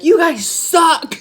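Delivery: high, loud, drawn-out insult; playful shouted put-down, about to laugh; hammed-up divine telling-off; mock-scolding God. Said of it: "he's basically like".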